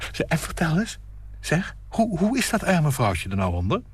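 high, keen question; eager gossip. Acted. Nina eagerly asking about the poor wife, high. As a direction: pounce on the gossip.

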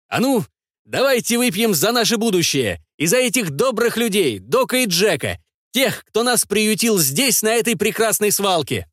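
rally the whole table; shouting toast. A narrator's reading. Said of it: "крикнул".

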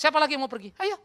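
loud, high, impatient challenge; acting out a forceful dismissal; sharp challenge. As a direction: challenge them to leave. Acting out Jesus challenging his followers.

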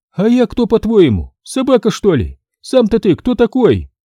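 наглый высокий женский голос; дерзко переспроси; нагло. Acted. Женщина огрызается. (обнаглевшая женщина дерзит мастеру)

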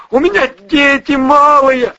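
begging. Acted. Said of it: "хриплым шёпотом взмолился Миша".

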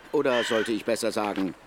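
ordinary delivery

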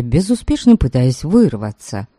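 ordinary delivery, nothing marked

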